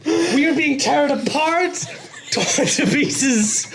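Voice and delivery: high, fast frantic ranting; Nick Cage rant. Acted. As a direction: rant wildly like Nicolas Cage. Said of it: "calm down, Nick Cage".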